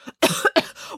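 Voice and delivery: acted smoke cough; choking little splutter.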